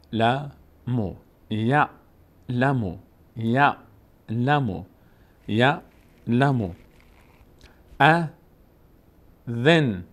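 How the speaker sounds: very slow, drawn-out teaching read; slow syllable reading. Shown as a demonstration. Teacher slowly sounds out the word for pupils. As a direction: spell it out slowly for learners. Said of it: "یا رو میچسبونیم به عین".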